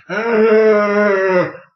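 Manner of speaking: loud high zombie moan; a big drawn-out groaning arr; mock groan. Imitating a street moaner's groan. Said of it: "in the street going".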